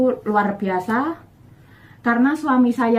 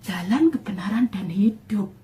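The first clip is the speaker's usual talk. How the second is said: awed hushed whisper of scripture. Retold or imitated. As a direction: murmur the words as if struck by them. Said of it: "Langsung des ini hati saya".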